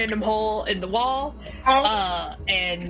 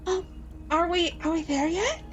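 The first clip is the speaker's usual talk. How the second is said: tiny high voice in your head; small sleepy sprite voice; pixie; 9 semitones higher than usual. Acted. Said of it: "you hear a little voice in your head".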